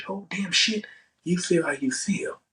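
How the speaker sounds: normal talking voice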